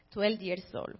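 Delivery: ordinary talk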